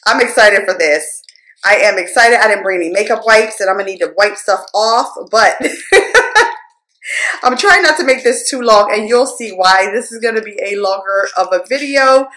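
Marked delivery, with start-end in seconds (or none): 5.6-6.5 light high-pitched laugh; amused laugh. Real, not acted. Laughs after a remark.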